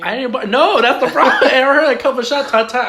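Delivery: high, loud laugh-talk; laughing loudly. Real, not acted. Tony laughs recounting the gunfire, loud and high. Laughing through shooting story.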